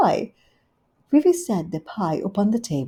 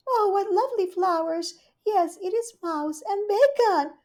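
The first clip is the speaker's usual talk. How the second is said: Rivy's voice; 13 semitones higher than usual. Acted. Rivy welcoming Duchess, high gushing hostess. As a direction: greet her sweetly and grandly.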